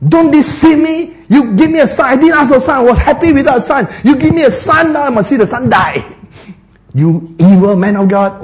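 high-pitched acted tirade, dropping at the end; blaming. Bereaved woman accusing the man of God, acted blame. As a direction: pile up the accusations in a high, protesting voice. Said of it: "he was really blaming the man of God".

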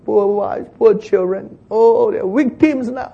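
high, slow wailing lament; whining complainers. Acted. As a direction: wail it like the grumbling crowd.

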